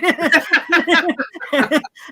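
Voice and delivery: loud high burst of real laughter; helpless laughing fit. Real, not acted. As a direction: crack up at the memory. Laughing hard at the movie.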